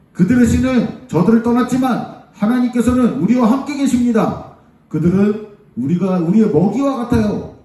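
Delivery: high, earnest acted appeal; Joshua and Caleb exhorting. Joshua and Caleb urging the people not to fear, high and emphatic. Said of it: "다른 이야기를 했어요".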